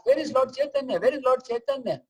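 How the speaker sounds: high-pitched, anxious repeated question; urgent searching. Retold or imitated. Devotee Vasudev looking for Lord Chaitanya, urgent high-pitched asking. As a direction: imitate a devotee desperately asking around.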